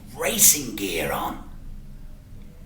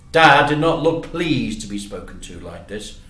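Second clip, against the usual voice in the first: plain reading voice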